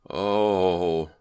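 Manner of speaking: a shocked, sickened oh; sickened cry. A narrator's reading. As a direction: gasp out at a horrible sight. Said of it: "Brent uttered a sickened cry".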